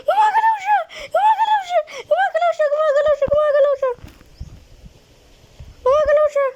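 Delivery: high loud frantic repeated yelling; panicked shrieking. Real, not acted. Mannequin moving, panicked repeats.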